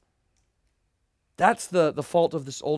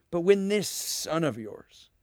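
disgusted; slower than usual. Retold or imitated. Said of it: "He's so disgusted and angry".

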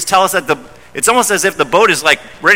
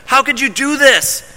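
horrified outcry; 9 semitones higher than usual. Retold or imitated. Sailors horrified.

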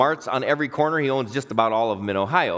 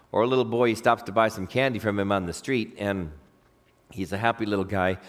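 ordinary delivery

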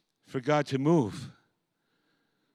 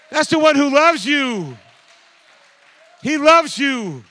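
preaching at full force; 11 semitones higher, 9 dB louder than usual. Real, not acted. Preacher crying out to the congregation about Jesus's power. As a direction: shout it out to the whole church.

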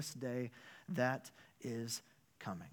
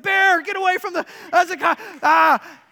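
much higher and louder panicked yell at his son; retold frantic shout to his son; 17 dB louder than usual. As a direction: scream the warning as the snake strikes. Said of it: "so now I'm yelling".

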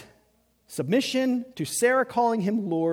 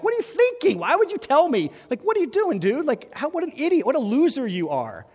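much higher, louder incredulous rant; outraged Pharaoh; 9 semitones higher, 4 dB louder than usual. Retold or imitated. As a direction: berate him like an idiot.

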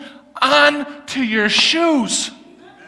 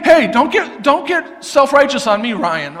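normal talking voice

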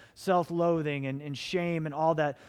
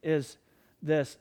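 ordinary delivery

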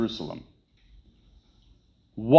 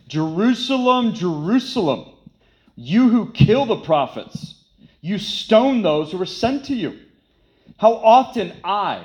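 lamenting cry; 6 semitones higher than usual. Acted. Preacher voices Jesus lamenting Jerusalem.

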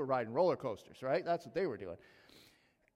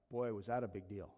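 ordinary delivery

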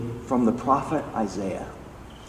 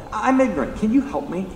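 high-pitched, open admission and plea; unafraid, humble asking; 9 semitones higher than usual. Retold or imitated. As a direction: act out asking for help without pride. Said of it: "He's unafraid to ask for help".

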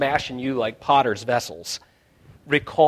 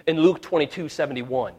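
usual speaking voice

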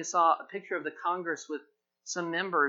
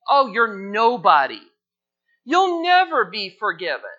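devil's accusation; 3 semitones higher, 10 dB louder than usual. Retold or imitated. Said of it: "the kind of things that the devil says".

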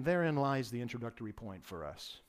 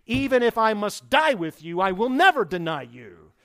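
loud, emphatic vow, pitched higher; raised voice; 7 semitones higher, 14 dB louder than usual. Retold or imitated. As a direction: Peter insisting he'll never fail. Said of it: "he raises his voice".

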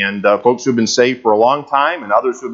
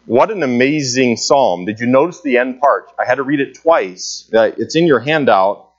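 usual speaking voice

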